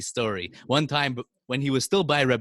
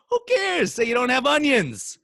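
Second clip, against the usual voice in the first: much higher, louder scoffing; mock dismissive; 13 semitones higher, 6 dB louder than usual. Storyteller voicing a scoffing listener, high and loud.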